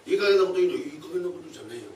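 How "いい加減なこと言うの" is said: angry thief. Acted.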